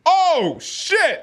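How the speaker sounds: high, loud cry of shock; shocked outburst. Acted. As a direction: yelp as if watching your friend's big slam. Acts out seeing the slam.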